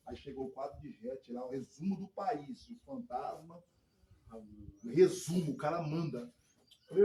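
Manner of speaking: ordinary talk, nothing marked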